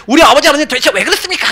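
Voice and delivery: high, quick mock protest; retold angry prayer. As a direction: complain to God in replayed exasperation. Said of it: "너무 화가 나갖고".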